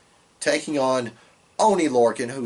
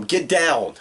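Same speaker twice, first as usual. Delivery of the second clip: retold yell, pitched up a little; mock yell; 4 semitones higher, 5 dB louder than usual. Wrestling fan acts out Gulak yelling at his opponent, mock yell. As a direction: yell it as an annoyed wrestler would, lightly. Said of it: "Gulak's just yelling".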